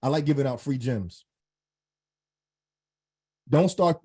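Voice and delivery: ordinary talk, nothing marked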